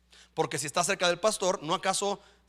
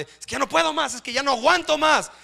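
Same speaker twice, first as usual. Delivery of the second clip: mock exasperated parent; 7 semitones higher, 4 dB louder than usual. Mocks a weary parent's complaint.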